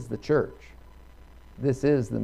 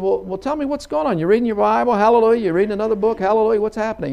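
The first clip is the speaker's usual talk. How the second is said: high, louder, baffled demand; mock each hallelujah back in a raised, agitated pitch; exasperated; 9 semitones higher, 5 dB louder, faster than usual. Son demanding his father explain the outbursts, exasperated.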